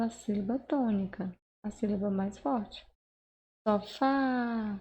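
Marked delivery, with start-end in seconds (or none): 3.7-4.8 "Sofá" with the final "fá" held long; stretched stressed syllable. Shown as a demonstration. Teacher showing where the accent falls, "fá" drawn out in "Sofá". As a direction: drag out the "fá" of "Sofá". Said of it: "como se eu fosse gritar essa palavra".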